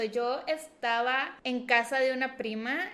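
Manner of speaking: ordinary delivery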